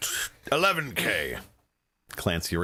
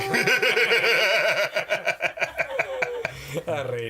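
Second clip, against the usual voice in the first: high delighted laugh; 12 semitones higher, 3 dB louder than usual. Acted. Mayor delighted by bid.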